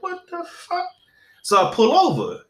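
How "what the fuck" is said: high, hushed squeak of disbelief; mock-stunned falsetto; retold disbelief. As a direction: squeak it softly, incredulous. Retelling shock at police behind him.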